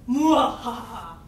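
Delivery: loud, slow villain cackle; evil laugh. Acted. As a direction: gloat with a big mwahaha.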